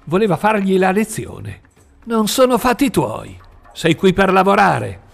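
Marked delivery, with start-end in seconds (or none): 2.0-5.1 higher, sharp angry retort; snarled retort. Acted. Foreman to the new worker, angry snarl. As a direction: snap it back at him. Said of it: "gli ringhiò contro".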